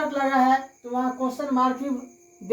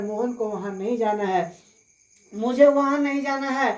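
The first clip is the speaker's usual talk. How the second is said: ordinary delivery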